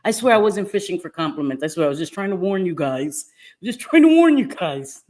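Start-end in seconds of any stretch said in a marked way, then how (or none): 3.6-5.0 high, loud, laughing-edged insistence; insistent protest. Real, not acted. Streamer denying she fished for compliments, insistent protest.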